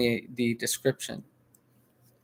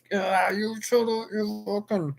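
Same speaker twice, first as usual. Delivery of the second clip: high, slow mock rant; pitched-up imitation of a screaming woman; mock yelling woman; 8 semitones higher than usual. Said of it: "the way they yell and get in your face".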